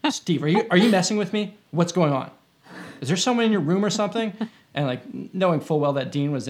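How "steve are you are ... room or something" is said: higher and louder, nervous and pressing, the last question rushed; imitating a spooked friend. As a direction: friend freaked out by the Ouija prank, accusing Steve: panicky questioning.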